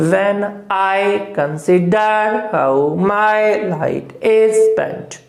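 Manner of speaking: when I consider how my light is spent, very slow and measured; iambic reading. Shown as a demonstration. Teacher rereading Milton's line aloud in iambic beats. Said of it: "पढ़ने का वापस आपको बता दें".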